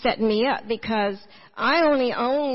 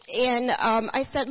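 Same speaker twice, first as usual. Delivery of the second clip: normal talking voice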